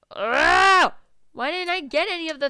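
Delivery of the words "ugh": loud, high groan that rises and falls; frustrated yowl. Real, not acted. Player misses out on the reward, frustrated yowl.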